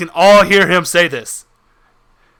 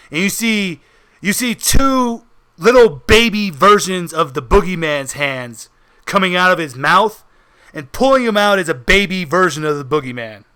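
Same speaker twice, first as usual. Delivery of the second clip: normal talking voice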